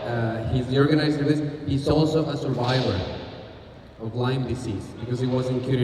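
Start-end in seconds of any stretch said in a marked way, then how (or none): none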